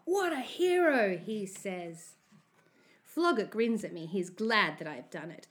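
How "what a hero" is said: high, slow, loud praise; admiring cheer. A narrator's reading. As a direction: draw out the praise. Bully congratulates the thief.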